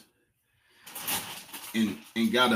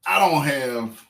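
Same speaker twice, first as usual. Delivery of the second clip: ordinary talk, nothing marked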